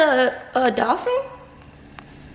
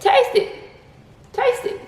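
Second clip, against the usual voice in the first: high playful coaxing; high coaxing sing-song; 8 semitones higher than usual. Real, not acted. Coaxing child, pitch up.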